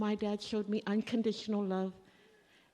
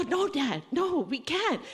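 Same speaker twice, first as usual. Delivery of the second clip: put-on high, pleading child on the verge of crying; frightened daughter pleading with Dad at the base gate; near tears; 5 semitones higher than usual. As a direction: beg Dad to stop, close to tears. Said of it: "I'm about to cry 'cause I'm scared".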